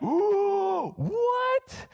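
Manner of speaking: high, drawn-out ooh, then a squeaked what; shocked gasp. Retold or imitated. Congregation shocked at the pastor, high gasping ooh. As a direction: gasp as if scandalised by the preacher.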